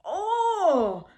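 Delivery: high, loud wail of frustration; long frustrated "oh", swooping up high; exasperated groan. Real, not acted. Reader fed up with a character, high exasperated groan.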